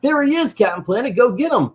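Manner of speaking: high-pitched kids' mock cheer; excited kids. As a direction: egg the hero on like cartoon children. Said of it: "all the kids are like".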